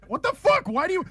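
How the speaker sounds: sudden high, loud disbelief; shocked outburst. Real, not acted. Reacting to the co-host refusing to cut anything, shocked high cry. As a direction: squeal it in disbelief.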